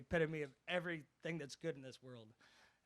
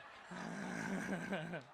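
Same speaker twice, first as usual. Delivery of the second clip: short laugh; 4 semitones higher, 3 dB louder than usual. Real, not acted. Laughs after teasing joke.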